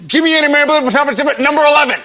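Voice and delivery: put-on high, rapid tumble through the kids' names; flustered scolding mom. Mom calling out a misbehaving youngest child, flustered and high. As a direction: imitate a mom fumbling for the right name. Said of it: "my mom had a little trouble remembering the names".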